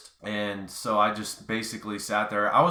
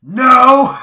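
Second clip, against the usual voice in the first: very loud, high, drawn-out NO; retold angry yell; 20 dB louder than usual. Hurt rider yells back down to dad, loud angry shout. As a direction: holler it back at full force. Yelled answer to dad from the ground.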